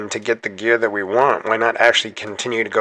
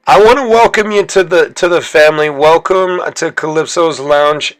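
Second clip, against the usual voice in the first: higher, louder welcoming call; excited welcome; 4 semitones higher, 5 dB louder than usual. Real, not acted. Streamer greeting a new character to the team, excited. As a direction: throw open the doors for a new hero.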